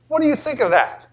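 ordinary talk, nothing marked

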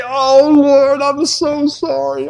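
whiny, high pleading to God; mock groveling prayer. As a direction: imitate someone begging forgiveness, hammed-up.

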